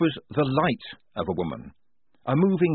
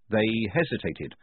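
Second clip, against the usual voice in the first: ordinary delivery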